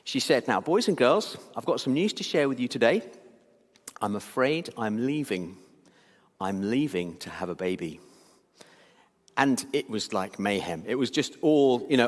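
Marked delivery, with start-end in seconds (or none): 0.5-8.0 gentle teacher. Retold or imitated. Teacher breaking sad news gently.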